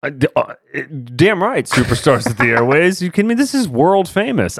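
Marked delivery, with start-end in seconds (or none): 1.2-4.6 mock boast. Boasting as podcast superstars.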